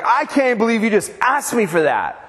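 playfully voicing the indignant daughter; mock indignant toddler. Pastor voicing his toddler's indignation at being asked for a cookie, mock offended. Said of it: "angry face".

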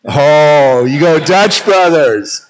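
slow, teasing nudge; teasing drawl. Acted. Pastor teasing Caitlin about her coffee shop, slow and teasing. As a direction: draw out the name like a joke.

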